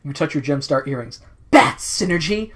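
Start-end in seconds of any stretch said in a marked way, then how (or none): 1.5-2.5 dramatic summoning. Acted. Heroine commanding Synergy to make bats, dramatic call. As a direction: call out the command, higher and louder. Heroine calls on her computer.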